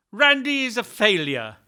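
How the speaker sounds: loud, firm order to repeat; commanding demand. Shown as a demonstration. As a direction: spell it out as an order. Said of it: "Say it".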